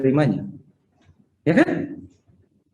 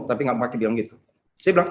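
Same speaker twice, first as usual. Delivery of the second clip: ordinary talk, nothing marked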